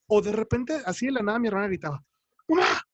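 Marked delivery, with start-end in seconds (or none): 2.5-2.8 sudden loud yell for mom; imitating a scream. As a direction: yell it out of nowhere, half asleep. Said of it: "Pero gritaba así fuertísimo". (grito repentino y fuerte)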